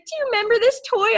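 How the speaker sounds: very high, fast eager mom voice; excited mom. Retold or imitated. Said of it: "She's like".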